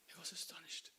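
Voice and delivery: hushed, breathless whisper with a gasp; awed whisper. Acted. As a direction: whisper it in awe. Awed telling of Prabhupada.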